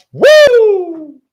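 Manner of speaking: high, loud triumphant woo; excited whoop. Real, not acted. Triumphant whoop after the quote.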